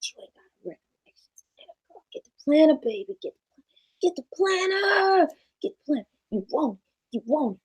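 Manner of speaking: hammed-up crackhead taunting, pushy and nagging; lightly voiced inner tempter daring her. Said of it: "My voice sounds like a New Jack City crackhead".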